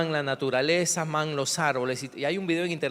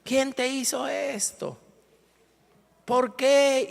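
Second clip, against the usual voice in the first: much higher, hammed-up wail; mock wailing cry; 9 semitones higher, slower than usual. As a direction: imitate mourners shrieking over a tree. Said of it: "Le gritan al árbol".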